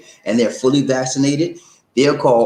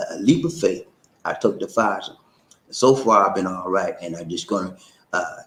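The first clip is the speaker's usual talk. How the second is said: ordinary talk, nothing marked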